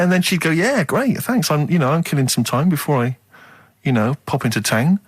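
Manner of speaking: ordinary talk, nothing marked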